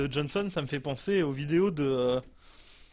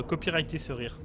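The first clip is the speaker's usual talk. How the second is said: ordinary talk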